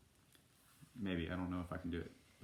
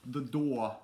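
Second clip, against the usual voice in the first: louder, higher British-accented "door"; 4 semitones higher, 5 dB louder than usual. Shown as a demonstration. Repeats word trying British accent.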